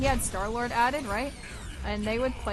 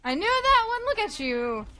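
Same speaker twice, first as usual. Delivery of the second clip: much higher, louder cheer; triumphant shout; 11 semitones higher, 7 dB louder than usual. Real, not acted. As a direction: yell it out in victory. Triumphant call after a kill.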